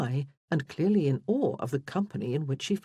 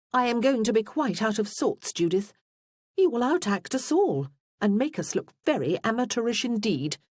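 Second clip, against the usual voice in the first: higher-pitched, put-upon complaint; mock-haughty sister; 7 semitones higher, 3 dB louder than usual. A narrator's reading. Freya teases Judith about outacting them all, mock-haughty. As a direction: lift the pitch in playful protest.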